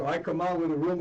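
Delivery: usual speaking voice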